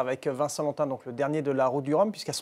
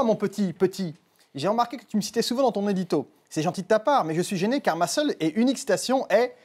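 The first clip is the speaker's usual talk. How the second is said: higher put-on voice reading a letter; Louis Fernandez; 7 semitones higher than usual. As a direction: read the fan mail in a raised character voice. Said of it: "cité un certain Louis Fernandez".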